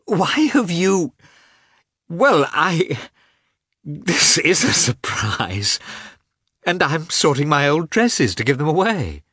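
bubbling over at his surprise visit; a young woman's happy fluster, slowing at the surprise; joyful. A narrator's reading. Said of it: "her face lit up with joy".

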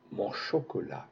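soft, thoughtful repetition; pensive. Acted. Little girl pondering her own chocolate, soft and thoughtful. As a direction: murmur it to herself, thinking. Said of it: "répéta Miette pensive".

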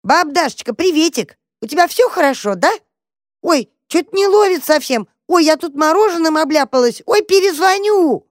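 put-on very high, chattering little girl; girlish squeal. A narrator's reading. Girl in the box phones granny, squeaky chatter. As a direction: squeak brightly, climbing to a shriek. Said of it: "запищал ящик девчачьим голосом".

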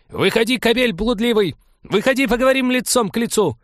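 high, loud yelling; furious shout. Acted. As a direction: bellow it at the locked door. Elvira bangs pan, calls him out.

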